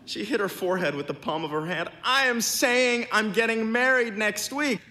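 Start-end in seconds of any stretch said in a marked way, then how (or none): 2.0-4.8 high, loud, emphatic repeat; exasperated shout. A narrator's reading. Bride repeats her news to a man who can't hear, exasperated shouting. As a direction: spell it out loudly over the noise.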